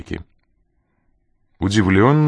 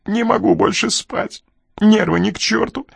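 very high, distraught whine; anxious complaint; 13 semitones higher than usual. A narrator's reading. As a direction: complain like a nervous wreck, voice pitched right up. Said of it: "пожаловался он, судорожно дыша".

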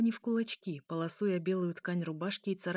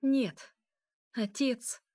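higher, louder cry of refusal; urgent protest; 5 semitones higher, 3 dB louder, much slower than usual. A narrator's reading. Elya cries out to her father, urgently. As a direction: call out urgently, voice lifted.

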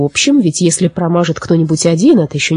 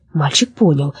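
ordinary delivery, nothing marked

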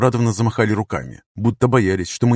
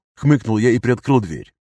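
ordinary delivery

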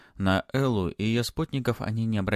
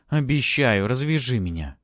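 put-on loud, higher, insistent girl; impatient promise; 5 semitones higher, 6 dB louder than usual. A narrator's reading. Tied-up girl promising Matvey, impatient and loud. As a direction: promise quickly and push the demand loudly.

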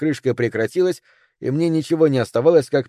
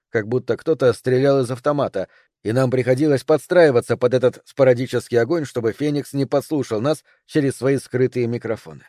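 plain reading voice

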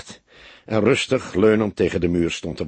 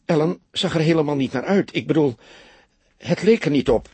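bewildered, voice raised higher; thinking it through aloud in dismay; taken aback; 5 semitones higher than usual. A narrator's reading. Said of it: "zei ze onthutst".

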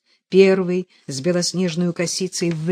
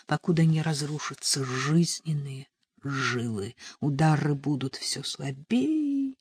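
ordinary delivery

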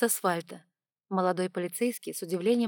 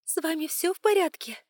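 a hint of a concerned stewardess, higher; gently worried, slightly higher question; lightly voiced worried flight attendant; 9 semitones higher, 5 dB louder than usual. A narrator's reading. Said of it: "с тревогой заглядывает".

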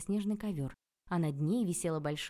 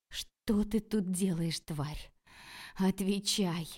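slow, seething accusation; angry demand; much slower than usual. A narrator's reading. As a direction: demand an answer from a hated rival. Furious rival demands an answer.

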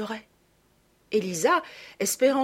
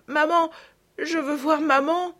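put-on frightened little girl; 8 semitones higher, 4 dB louder than usual. A narrator's reading. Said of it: "dit-elle".

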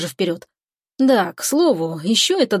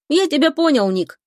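higher-pitched, giving in; resigned sigh; 7 semitones higher than usual. A narrator's reading. Cousin accepting a refusal on the phone, sighing. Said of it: "вздыхает братец".

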